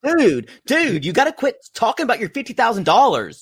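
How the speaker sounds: high, fast exasperated scolding; mock fed-up dude voice. Friend scolds a bragging man.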